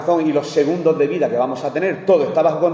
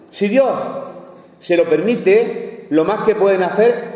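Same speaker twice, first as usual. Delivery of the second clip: higher, louder, drawn-out proclamation; preaching at full force; 5 semitones higher, 3 dB louder, slower than usual. Acted. Preacher stresses to the congregation that God must permit it, loud and slow. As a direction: build it up like a pulpit climax. Preaching climax, raised and slowed.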